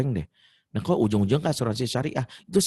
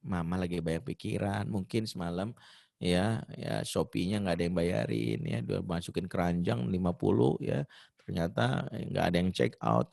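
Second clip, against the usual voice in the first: put-on calm the kid down softly; soft, gentle dad reassuring his child; soft soothing father; 6 semitones lower, 6 dB quieter than usual. Husband gently explaining to child.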